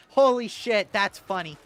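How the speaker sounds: usual speaking voice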